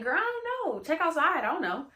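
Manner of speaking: mock-casual shrug of a reply; dismissive friend. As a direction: toss it off like it's no big deal. Friend brushing off the question.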